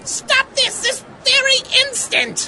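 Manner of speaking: high scolding. Acted. Scolding flirting wife.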